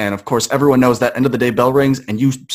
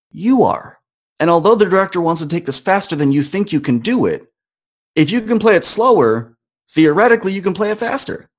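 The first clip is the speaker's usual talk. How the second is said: higher, driving self pep talk; 6 semitones higher than usual. Retold or imitated. Student pumping himself up about his playing, higher and urgent. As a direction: fire yourself up.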